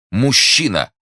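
high, loud outburst; indignant exclamation. Acted. Young lover learning a man awaits her, indignant cry. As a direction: throw the word out in jealous protest. Jealous repeat of his exclamation.

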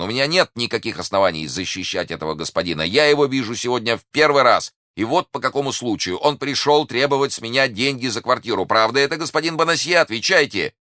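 high-pitched, insistent public denial; loud speech. A narrator's reading. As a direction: build to a demanding, high-pitched challenge. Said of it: "сказал громко Д'Артаньян". (громко и требовательно)

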